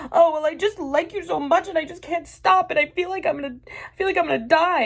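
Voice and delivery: high, gushing lovesick whine; mock drunk girl. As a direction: imitate a drunk girl's dramatic confession. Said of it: "also drunk. So she's like".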